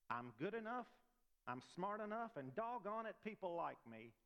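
a hint of a character reciting affirmations, lifted in pitch; Stuart Smalley. Preacher recites mirror affirmations to congregation, lightly mimicking Stuart Smalley. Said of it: "Y'all remember Stuart Smalley?".

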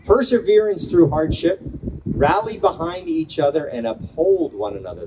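pausing between short poetic lines; poem reading. Acted. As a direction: read each phrase like a line of verse. Reads a student's poem aloud.

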